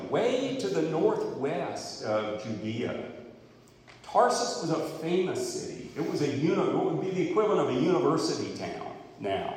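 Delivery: ordinary talk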